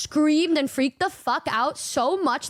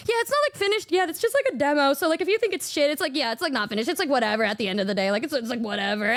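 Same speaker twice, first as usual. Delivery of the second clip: nervous rambling; faster than usual. Retold or imitated. Showing a new song to a musician, nervous fast rambling. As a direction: rush it out, brushing it off. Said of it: "I was like".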